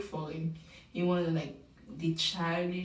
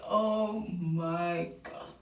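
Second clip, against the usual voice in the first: slow, drawn-out disbelief; exasperated; 2 semitones lower, much slower than usual. Retold or imitated. Viewer reacting to Grant's confession, slow exasperation. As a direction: stretch it in weary disbelief. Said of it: "I'm like".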